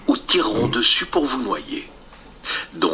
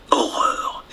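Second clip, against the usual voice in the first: horrified whisper. A narrator's reading. Narrator exclaims in horror.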